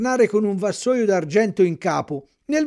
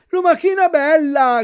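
much higher, loud cry up to the window; shouted; 10 semitones higher, 7 dB louder than usual. A narrator's reading. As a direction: shout up to the snail, pleading. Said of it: "gridò Pinocchio dalla strada". (Pinocchio grida alla lumaca dalla strada)

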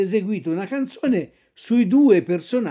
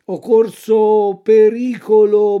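plain reading voice

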